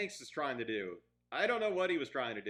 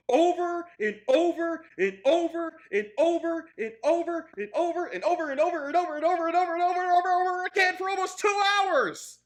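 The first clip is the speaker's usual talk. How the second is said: high, loud repetition speeding into a torrent; exasperated shouting; 11 semitones higher, 10 dB louder than usual. Real, not acted. Reviewer fed up with two hours of over-explaining, exasperated shouting. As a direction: pile up the words faster and faster.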